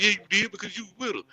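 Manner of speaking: mock accusing dude. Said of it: "I remember a dude that".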